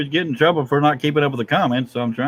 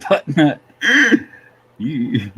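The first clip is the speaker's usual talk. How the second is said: ordinary delivery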